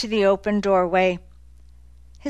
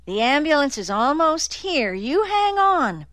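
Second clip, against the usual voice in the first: urgent; 7 semitones higher than usual. Acted. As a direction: make him hold on, urgently. Nick urges dying man.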